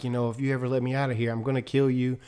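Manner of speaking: imitating the crazy prisoner's calm, soft threat; eerily calm. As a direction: say it quietly and matter-of-fact, like a calm madman. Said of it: "real calm and was like".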